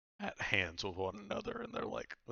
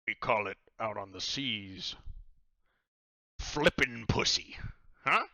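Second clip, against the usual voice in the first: pirate captain; 3 semitones higher, 7 dB louder than usual. Acted. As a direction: boom it out like a cheery pirate host. Leans in, names the game.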